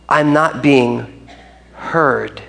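a touch of hurt, slowly; mild hurt. Retold or imitated. Congregants complaining to leaders, slow and mildly hurt. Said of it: "people are saying".

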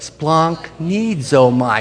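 "splanchnizomai" drawn out slowly, syllable by syllable; demonstrated pronunciation. Shown as a demonstration. Preacher showing how to pronounce "splanchnizomai", slow and drawn out. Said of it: "You wanna say it with me?".

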